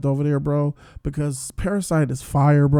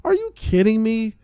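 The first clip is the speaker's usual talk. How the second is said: high, loud disbelief; can't believe what the show did; incredulous; 7 semitones higher, 4 dB louder than usual. Real, not acted. Viewer reacting to the hero's choice, high disbelief.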